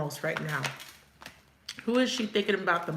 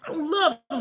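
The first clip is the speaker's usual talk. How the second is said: higher, half-laughing fake bravado; mock heartbroken vow; 6 semitones higher than usual. Mimicking a client pretending she is over him, laughing. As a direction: play the jilted girl swearing him off. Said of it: "And you tell yourself every day".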